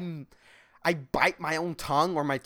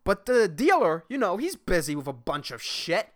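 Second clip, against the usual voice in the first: ordinary talk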